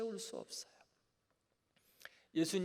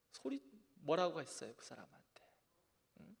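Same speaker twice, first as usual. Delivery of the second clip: normal talking voice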